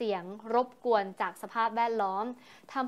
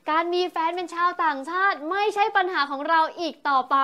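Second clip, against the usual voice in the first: excited; 6 semitones higher, 7 dB louder than usual. Real, not acted. Gushing about a foreign partner.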